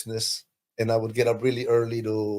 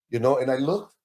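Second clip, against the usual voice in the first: ordinary talk, nothing marked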